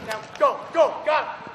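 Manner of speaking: loud, high urging calls; urging shout. Real, not acted. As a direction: push him on, loud and high. Coach urging player during drill.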